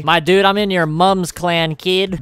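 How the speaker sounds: ordinary delivery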